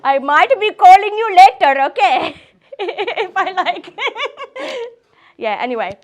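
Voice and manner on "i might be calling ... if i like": loud, high-pitched joking through laughter; high, giggly and loud; laughing. Real, not acted. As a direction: burst into giggles as you tease. Joking reply amid laughter.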